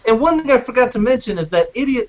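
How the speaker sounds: usual speaking voice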